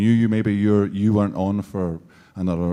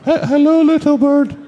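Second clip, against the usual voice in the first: Hello, little bird as a strangled, loud squeal; cracking squeak; 10 dB louder than usual. Retold or imitated. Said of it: "And you're going".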